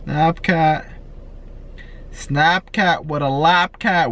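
normal talking voice

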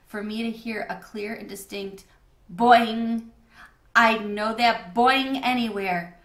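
usual speaking voice

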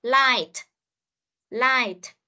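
Light with a released t at the end; final T demo. Shown as a demonstration. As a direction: model Light, sounding the final t clearly. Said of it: "phát âm phải có T này".

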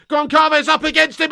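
ordinary talk, nothing marked

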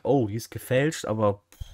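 ordinary delivery

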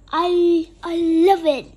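loud, happy storybook outburst; delighted exclamation. A narrator's reading. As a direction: exclaim it with delight at full volume. Vampire delighted with new gold fang.

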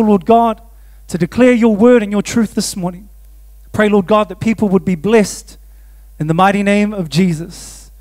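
low, earnest prayer voice before the sermon; opening prayer. Acted. Pastor praying over the congregation, earnest prayer. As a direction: pray over the gathering, unhurried.

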